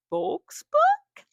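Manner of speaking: very high, loud burst of excitement; real high-pitched fangirl excitement; excited squeal. Real, not acted. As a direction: squeal it out, thrilled. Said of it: "I'm so excited".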